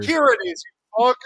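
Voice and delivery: loud, high acted exasperation; mock angry snap. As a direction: throw it back at your partner, mock-furious. Acting out a couple's quarrel.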